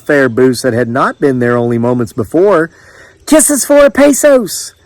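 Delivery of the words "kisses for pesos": high, drawn-out vendor's call; lovely female voice. A narrator's reading. Island princess selling kisses to the crowd, high lilting call. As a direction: call it sweetly across the way. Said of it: "came the voice again".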